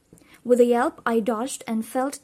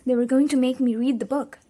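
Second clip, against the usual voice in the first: plain reading voice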